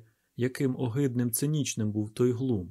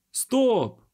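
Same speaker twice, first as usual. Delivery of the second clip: high, loud cry to halt; sudden ringing yell; loud ringing shout; 11 semitones higher, 6 dB louder than usual. A narrator's reading. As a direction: shout to stop everyone. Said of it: "крикнув голосно і дзвінко дикун".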